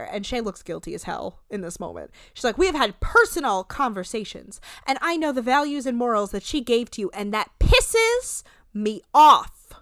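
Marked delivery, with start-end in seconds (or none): 2.6-9.7 Monique dramatic reading. Retold or imitated. Podcaster imitating host fed up with young guest, dramatic reading. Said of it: "doing a Monique dramatic reading".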